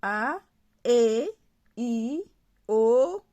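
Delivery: each vowel set apart, E and O loud; vowels recited. Shown as a demonstration. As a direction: call out the vowels one by one. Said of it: "ce sont les voyelles orales". (voyelles énoncées une par une)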